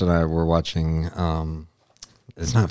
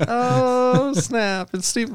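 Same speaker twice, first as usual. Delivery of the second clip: mock recognition; much slower than usual. Imagined star recognition, mock excited.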